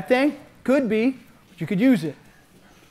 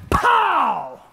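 high pow sound for a punch; comic-book punch sound; mock pow; 10 semitones higher, 4 dB louder than usual. Shown as a demonstration. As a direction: pop the word out like a hit. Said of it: "My next shot keep coming".

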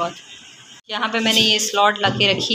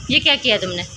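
high coaxing mom voice; 8 semitones higher, 3 dB louder than usual. Real, not acted. Mother coaxing her angry daughter to draw, high and sing-song.

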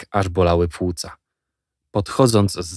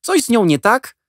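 put-on curious boy; 5 dB louder than usual. A narrator's reading. Boy questions Jack.

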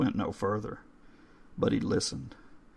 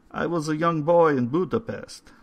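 put-on higher, louder old foreign gentleman; old caretaker voice; 7 semitones higher, 5 dB louder than usual. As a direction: read the stone like a courteous old man. Old man begins his tale.

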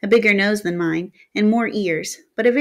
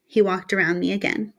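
plain reading voice

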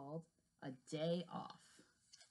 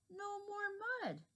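high pleased voice; 13 semitones higher than usual. Acted. Character pleased after swim.

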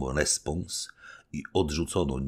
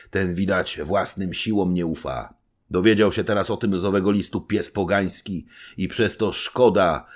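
stern rebuke; 5 semitones higher, 7 dB louder than usual. A narrator's reading. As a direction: scold with grave contempt. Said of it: "Na to Wołodyjowski".

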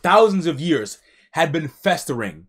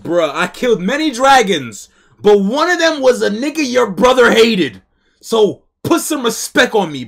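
cocky Murakan; 6 semitones higher, 7 dB louder than usual. Retold or imitated. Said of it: "He said".

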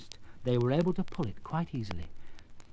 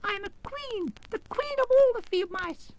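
indignant mouse queen. A narrator's reading. Mouse queen announcing her rank, indignant squeak. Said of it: "cried the little animal indignantly".

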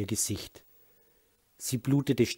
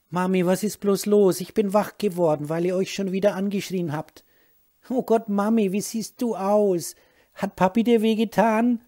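put-on frightened little boy; 9 semitones higher, 6 dB louder than usual. Scared son sees beaten mother.

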